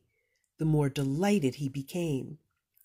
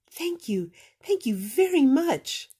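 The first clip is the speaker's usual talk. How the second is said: high, loud delighted thanks; overjoyed thanks; 10 semitones higher, 7 dB louder than usual. Acted. Little blue fish thanking Rainbow Fish, high and loud. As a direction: burst with joy.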